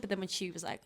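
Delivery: ordinary talk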